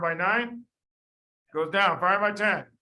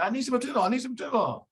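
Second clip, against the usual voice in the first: lightly voiced doctor pleading, pitched higher; a hint of a flustered doctor asking again; mock helpless doctor; 8 semitones higher, faster than usual. Doctor pleading with the pharmacist for atenolol, a light mock whine.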